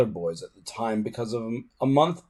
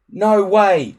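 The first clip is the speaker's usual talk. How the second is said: stubborn; 8 semitones higher, 8 dB louder than usual. A narrator's reading. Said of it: "said Hiccup stubbornly".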